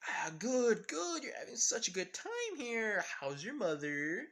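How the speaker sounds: nervous fake cheer. Karin nervously overdoing the small talk.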